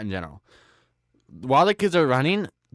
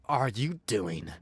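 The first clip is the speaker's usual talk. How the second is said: angry wife; 2 semitones higher, slower than usual. Retold or imitated. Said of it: "she's like".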